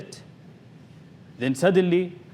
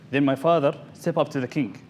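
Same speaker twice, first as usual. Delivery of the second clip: ordinary delivery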